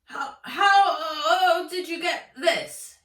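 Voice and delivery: strangled, panicked headmaster. Acted. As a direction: force it out through a tight throat. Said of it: "his voice strangled".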